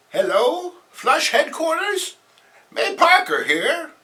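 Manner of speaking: put-on very high, slow old-lady voice; high slow aunt answering the phone. Aunt voice third take.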